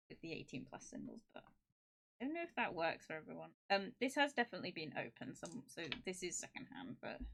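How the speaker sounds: ordinary talk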